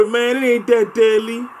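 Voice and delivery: high, loud mocking bot voice. Mocking the bots' advice to the video, in a loud high sneer. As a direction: sneer it back like the dumb bots would. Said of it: "That's what the dumbass bots be saying".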